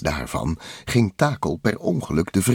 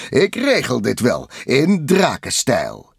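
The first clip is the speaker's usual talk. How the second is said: confident hero; 5 semitones higher, 4 dB louder than usual. A narrator's reading. Hero confidently takes over the fight.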